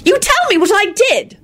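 very high distressed demand; upset, high-pitched plea; wailing. Acted. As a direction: wail it insistently. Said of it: "Elizabeth wailed".

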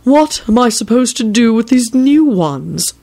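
high, drawn-out exasperated question; cross. A narrator's reading. Man grumbles to companion about newcomers, cross. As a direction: complain crossly, stretching the words. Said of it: "Barnabas said crossly to Radu".

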